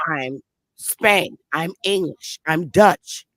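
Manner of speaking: ordinary delivery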